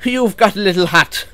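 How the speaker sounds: big boisterous teasing; guffawing bus. Acted. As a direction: crow it out loudly, poking fun. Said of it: "he guffawed".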